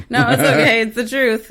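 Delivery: laughing out the words, loud and high. Real, not acted. Laughing, telling the host it's fine. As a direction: reassure him through a big laugh. Laughing after guest's taxes confession.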